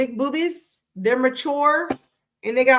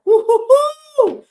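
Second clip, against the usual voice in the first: high, loud, slow taunting woo-hoo; much higher loud mocking whoop; mocking hoot; 13 semitones higher, 9 dB louder, much slower than usual. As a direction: hoot at them like a teasing trickster. Taunting mind-game player.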